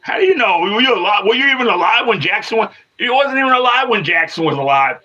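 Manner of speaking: incredulous retort. Real, not acted. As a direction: fire back in disbelief, voice jumping up. Arguing back at a chat commenter.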